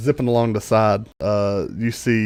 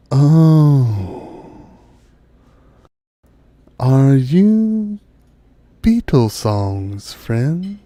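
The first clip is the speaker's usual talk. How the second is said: slow, drawn-out dryad voice, raised in pitch; stately dryad; 3 semitones higher, 5 dB louder, much slower than usual. Acted. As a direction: draw each word out like an ancient tree spirit. Game master voices the dryad.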